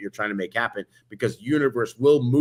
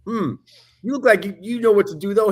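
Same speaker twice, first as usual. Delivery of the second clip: security guard voice; 10 semitones higher, 5 dB louder than usual. Retold or imitated. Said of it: "he goes".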